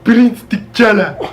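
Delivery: slow, loud mock African accent; grand African accent, drawn out. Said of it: "I hated the way he said".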